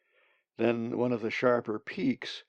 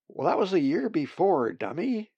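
higher, teasing put-down; a raven calling another bird a dummy, teasing; mocking squabble; 7 semitones higher, 5 dB louder than usual. A narrator's reading. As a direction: sneer it at the other bird.

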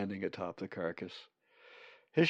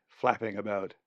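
plain reading voice

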